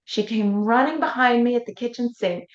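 ordinary delivery

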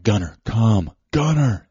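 firm, rising-urgency call to a dog. Retold or imitated. Calling his dog more insistently.

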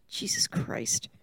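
low, breathy whisper. Real, not acted. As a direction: breathe it out in disbelief. Reacting to house prices.

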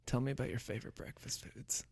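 soft close-mic whisper. Pretend. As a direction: breathe it into the headphones. Playing headphone chicken, soft voice.

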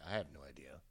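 a hushed, shrugging whisper. Retold or imitated. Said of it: "he's like".

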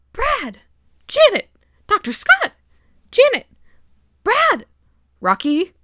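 high, loud exclaimed names in turn; shocked name-calling. Retold or imitated. Teller reenacts characters catching each other, shocked name-calling. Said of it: "this is how the scene goes".

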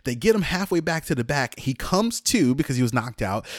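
usual speaking voice

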